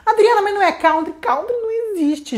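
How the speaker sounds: high questioning voice saying country as kawtri; mimicking a student. Student asks teacher, high puzzled kawtri. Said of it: "Eu sei que você escuta".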